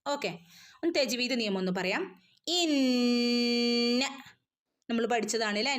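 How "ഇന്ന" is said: ഇന്ന with a long humming nasal; ghunna. Shown as a demonstration. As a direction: hold the ന്ന hum long. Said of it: "നന്നായിട്ട് മണിച്ചു കൊടുക്കണം". (ഇന്ന ഗുന്നയോടെ)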